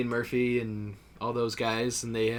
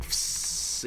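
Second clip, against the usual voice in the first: imitated spray-can hiss; 4 dB quieter than usual. Shown as a demonstration. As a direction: hiss like weed killer spraying. Imitates a weed killer spray.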